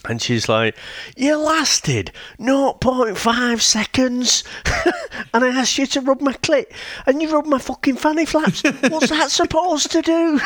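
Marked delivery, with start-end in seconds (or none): 1.2-10.4 put-on pitched-up Wigan accent, scornful. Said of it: "it sounds like it's from Saint Helens or Wigan".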